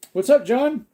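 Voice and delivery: high, bright hello; excited greeting. Real, not acted. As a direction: call out a cheery hello. Greeting a viewer joining.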